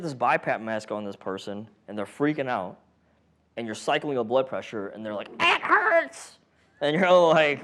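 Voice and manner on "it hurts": much higher, shrill-edged patient cry; whiny patient. Retold or imitated. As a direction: squeal it like a patient fighting the cuff. Voices a panicking patient.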